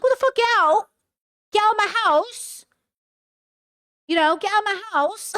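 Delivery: mock furious yell. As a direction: shriek like a boyfriend throwing her out. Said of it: "He's like".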